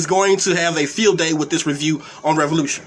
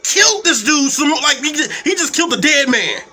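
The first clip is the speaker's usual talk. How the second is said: high-pitched incredulous rant; worked-up disbelief; exasperated protest; 5 semitones higher than usual. Acted. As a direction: argue it's finished, voice raised high. Acted protest at the needless stomping.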